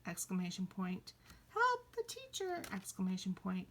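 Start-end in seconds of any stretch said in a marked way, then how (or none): 1.5-2.6 very high mock plea; squealed playful appeal; exclaimed cry for help. Shown as a demonstration. Teacher begs class in demo, exclaimed cry. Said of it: "might use an exclamation point for".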